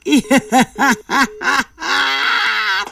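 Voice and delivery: long amused laugh; a real, delighted laugh. Real, not acted. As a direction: burst out laughing at the caller. Caller only says good night.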